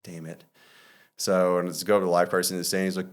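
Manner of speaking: ordinary talk, nothing marked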